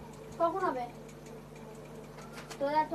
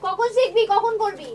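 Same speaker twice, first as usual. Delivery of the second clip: loud, raised teacher scold; angry scolding; 3 semitones higher, 8 dB louder than usual. Real, not acted. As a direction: shout at a lazy pupil. Teacher scolding a lazy student. (রাগী গলায় জোরে বকুনি)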